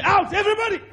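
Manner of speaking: high, loud order to leave; commanding shout. Retold or imitated. Preacher ordering the family out, shouting. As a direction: bark it out to clear the room.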